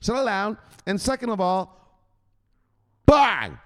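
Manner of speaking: high, loud scolding enforcer. Retold or imitated. Enforcer Andrew scolding a panicking viewer, loud and high. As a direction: lay into him like a tough boss. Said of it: "He's gonna tell you, first of all".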